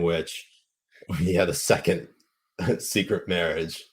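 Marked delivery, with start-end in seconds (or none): none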